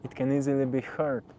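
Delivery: normal talking voice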